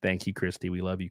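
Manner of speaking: normal talking voice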